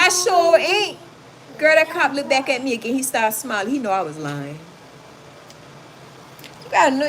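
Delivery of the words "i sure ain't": mock innocence. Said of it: "I said".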